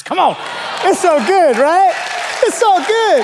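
high, loud celebrating shout; playful hype. Real, not acted. Pastor celebrating no height requirement for pastors, hyped-up. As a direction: whoop it up for the crowd. Hyping crowd after his joke.

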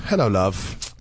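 put-on a light British accent, condescending; patronizing British accent. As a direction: greet her in a mock patronizing British accent. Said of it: "the patronizing accent".

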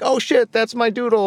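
high, thrilled cry of recognition; excited discovery. Retold or imitated. Friend spots his NFT in the gallery, thrilled and high. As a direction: blurt it out, amazed to see your own art. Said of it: "he's like".

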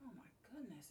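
breathy whisper, no voice; whispered exclamation. Real, not acted. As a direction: gasp it out in a whisper. Reacting to the sleep question.

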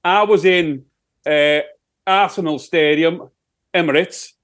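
put-on Sheffield accent. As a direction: answer as a broad Sheffield rock singer. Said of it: "it's my Sheffield accent".

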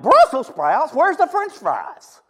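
mock outrage over brussels sprouts; indignant disbelief. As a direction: whine it like a let-down kid. Teen disgusted at lunch.

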